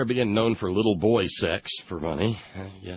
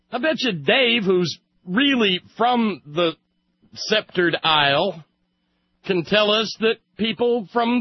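much higher and louder, hammed-up British accent; mock British accent; 11 semitones higher, 4 dB louder than usual. Host teasing his co-host, hammed-up mock British accent. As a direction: camp it up like a fake Brit, scoffing. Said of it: "I sound like an advertisement for Arthur Treacher's".

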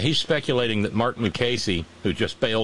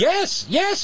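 high, excited confirmation; emphatic yes; 3 dB louder than usual. Real, not acted. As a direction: insist it really happened, pitch up. Emphatic agreement.

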